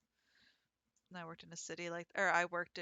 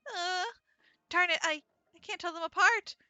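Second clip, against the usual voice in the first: high, loud exasperated character voice; flustered heroine; 11 semitones higher, 7 dB louder than usual. Acted. Girl can't tell rock doves apart, flustered. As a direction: blurt it out in a higher, frustrated voice.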